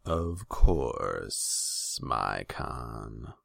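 serpent advisor with drawn-out sibilants, falling low at the end; snake-like deference to a ruler; hissing. A narrator's reading. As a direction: concede smoothly with a hiss. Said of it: "A voice hissed in the shadows".

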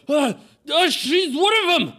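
high, loud, panicked yell; terrified old man. Acted. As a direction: shriek the alarm as a frightened elderly villager. Old man falls back, terrified.

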